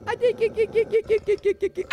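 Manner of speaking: high, playful rapid-fire repeats; squeaky chattering. Pretend. Player teasing the teeth with a finger, squeaky.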